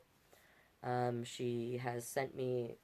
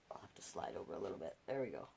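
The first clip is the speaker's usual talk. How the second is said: quiet; 10 semitones lower, 12 dB quieter, much faster than usual. Real, not acted. Adjusting camera aside.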